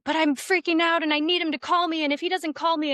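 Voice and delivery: a hammed-up frantic girl; imitating anxious friend. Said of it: "she's like".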